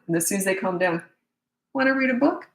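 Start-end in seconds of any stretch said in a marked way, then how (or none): 1.7-2.5 high, rising friendly invitation; cheerful shift. Shown as a demonstration. Parent as soon as child calms down, cheerful invitation. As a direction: switch to a sweet, no-grudge offer. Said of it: "that's that shift".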